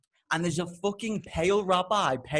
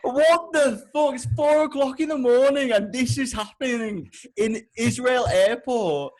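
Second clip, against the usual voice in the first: exasperated disbelief; 6 semitones higher, 4 dB louder than usual. Retold or imitated. Traveller at four in the morning, appalled disbelief. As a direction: start aghast and loud, easing off.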